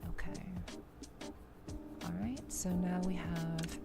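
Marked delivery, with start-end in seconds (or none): none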